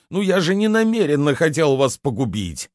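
high-pitched self-excusing plea; pleading. A narrator's reading. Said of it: "взмолился Рувен". (умоляюще оправдываясь, высоким голосом)

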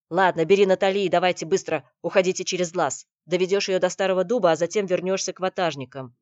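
loud, firm orders; urgent command. Acted. Chieftain sends Никита away with Натали, loud commanding. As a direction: give orders at full voice.

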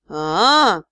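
long low drawn aah. Acted. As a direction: stretch the aah out. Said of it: "протянул лорд Тьер".

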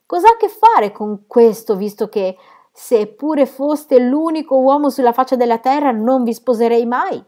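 furious cry. A narrator's reading. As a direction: throw it at him with full force. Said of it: "gridò".

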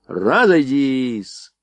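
long-drawn parade command; slow, called-out order; military dismissal. A narrator's reading. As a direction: stretch it out to dismiss the ranks. Officer dismisses the lined-up soldiers.

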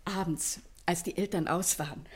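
ordinary talk

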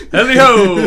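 high, loud chipper greeting; cheery neighbor hollering hello; Ned Flanders. Retold or imitated. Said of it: "He's like Ned Flanders".

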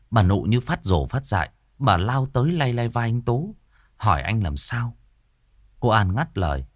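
ordinary delivery

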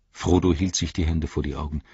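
ordinary delivery

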